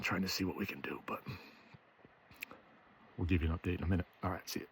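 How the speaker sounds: soft, low hunting murmur; hushed voice. Real, not acted. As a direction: keep it hushed so the deer stay put. Hushed talk near deer.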